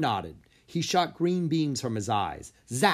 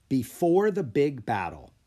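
ordinary delivery, nothing marked

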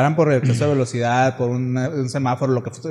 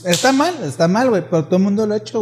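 insistent; 6 semitones higher than usual. Real, not acted. Defending in a heated way.

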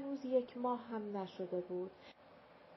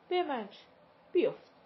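cold scornful retort; 3 semitones higher, 6 dB louder than usual. A narrator's reading. Mother coldly refusing.

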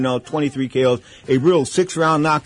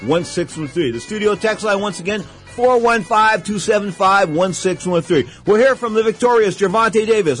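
radio announcer; 5 semitones higher than usual. Acted. Radio host opening the show.

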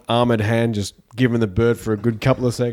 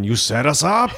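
Predator movie quote; 4 semitones higher than usual. Retold or imitated. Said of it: "Predator line".

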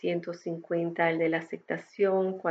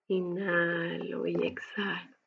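slow, softer whispered cue; whispering; 5 dB quieter, slower than usual. Acted. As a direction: breathe the words out in a whisper. Breathing cue in meditation. (susurro lento)

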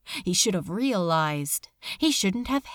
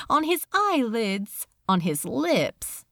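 ordinary delivery, nothing marked

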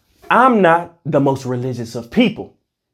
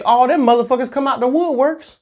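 ordinary talk, nothing marked

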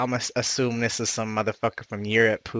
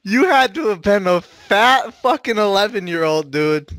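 loud teasing; 10 semitones higher, 7 dB louder than usual. Real, not acted. Teasing shout while laughing.

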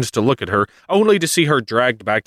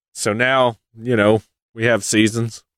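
normal talking voice

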